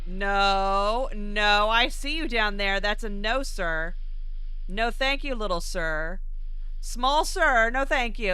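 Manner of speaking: emphatic refusal. Real, not acted. Player warding off something spotted below, loud refusal. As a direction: push the refusals out loudly.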